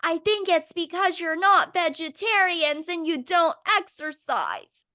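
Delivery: high, loud mocking impression of a book character; slow down on the last word, mockingly; mock heroine. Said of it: "And she's like".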